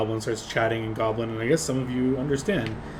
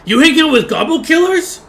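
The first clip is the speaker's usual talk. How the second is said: much higher, louder goblin yelp; goblin accusation; 12 dB louder than usual. Acted. Goblin accusing the party to goblins in back, high loud yelp. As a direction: call out to the goblins behind. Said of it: "cranes his neck around ... and says".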